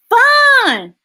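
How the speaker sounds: loud, high, drawn-out cheer; gleeful exclamation. Acted. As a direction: whoop it out for the kids. Exclamation in the story.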